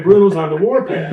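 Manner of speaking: usual speaking voice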